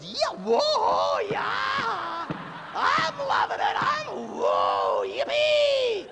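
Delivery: very high, whooping mock ecstasy; loud falsetto party cheer; thrill-seeking inner voice. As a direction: whoop with wild hammed-up glee. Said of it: "Where this voice goes".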